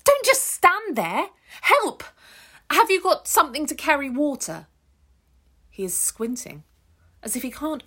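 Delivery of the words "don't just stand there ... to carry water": much higher, loud desperate shouting; frantic yell. Acted. Ruth yelling at the idle stranger, frantic high shout. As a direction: scream at him to stop standing there and help.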